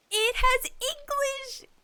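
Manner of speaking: high, slow, stretched disbelief; incredulous. Real, not acted. To viewers, slow incredulous disbelief. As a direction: say it like they can't believe it.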